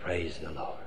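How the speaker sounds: hushed, resolute praise. Acted. Boss repeats praise despite tragedy.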